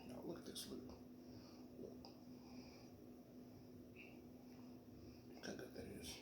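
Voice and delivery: soft whisper showing off the food; hushed, close to the mic. Real, not acted.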